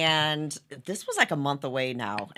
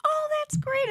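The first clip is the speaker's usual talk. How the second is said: mock cheerful husband. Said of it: "And he's like".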